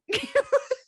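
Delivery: real giggle at the memory; high burst of laughter. Real, not acted. Laughing at own story.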